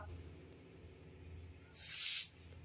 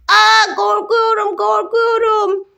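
higher, slow, loud hammed-up wail; mock scared cry; 6 semitones higher, 5 dB louder, slower than usual. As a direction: ham up a toy's terror of the needle. Toy afraid of the injection.

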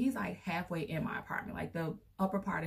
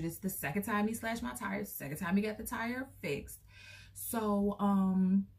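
ordinary talk

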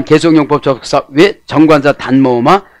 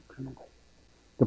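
whisper; 2 semitones lower, 25 dB quieter than usual. Real, not acted. Preacher's aside after voiced rebuke.

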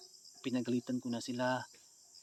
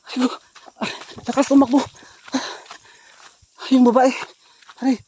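high, very loud cries of pain and protest; alarmed yelling; 13 semitones higher, 17 dB louder than usual. Real, not acted. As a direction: cry out as if shoved mid-chase. Pushed, crying out mid-chase. (pasigaw na pag-aray at pagrereklamo)

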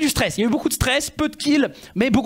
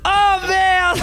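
high, loud shocked yelp; shocked cry; 12 semitones higher, 5 dB louder than usual. Real, not acted. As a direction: cry out as you get caught. Gets killed suddenly.

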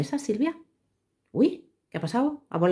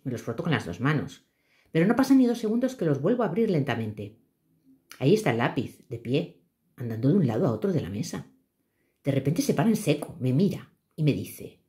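calm storytelling read; story narration; 4 semitones lower than usual. A narrator's reading. Reads her own story aloud.

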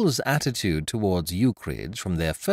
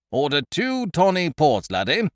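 loud, breezy and higher; a jolly bluff chap chattering on; hearty Ukridge; 9 semitones higher, 6 dB louder than usual. A narrator's reading. Ukridge telling his friend to remember a tune, hearty.

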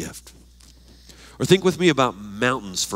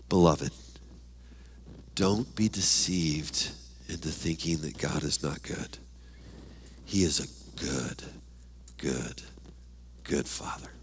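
much softer and lower, lingering on good; tender appeal; 8 semitones lower, 13 dB quieter than usual. Real, not acted. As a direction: gently reassure the flock.

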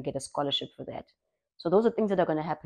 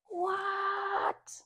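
high, far-off shocked what; shocked what, much higher; disbelieving what; 10 dB quieter than usual. Retold or imitated. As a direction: squeal it in disbelief. Reacting to mom's lecture idea.

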